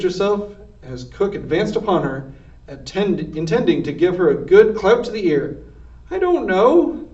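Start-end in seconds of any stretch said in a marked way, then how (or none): 6.1-7.1 "I don't know" much higher and pleading; scared whine. A narrator's reading. Mig bracing as Cook advances, high scared whine. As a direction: squeal it out high and afraid.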